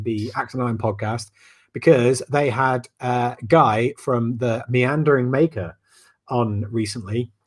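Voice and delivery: ordinary delivery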